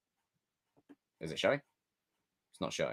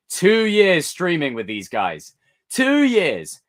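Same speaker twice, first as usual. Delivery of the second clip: excited; 8 semitones higher, 7 dB louder, much slower than usual. Real, not acted. Excited about the anniversary.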